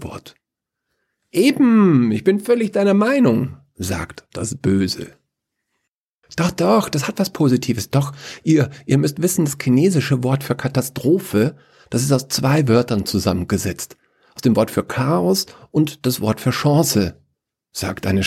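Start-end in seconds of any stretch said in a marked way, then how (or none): 1.3-3.6 high, emphatic agreement; gleeful Evil. Acted. Evil siding with the pessimist, gleeful. 6.4-17.2 higher, eager, stumbling argument; insistent Chaos. Acted. Chaos lecturing from under the table, insistent.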